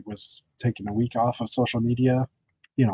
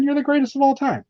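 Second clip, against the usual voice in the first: excited praise; 11 semitones higher than usual. Real, not acted. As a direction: cheer him on. Praising Dave loudly.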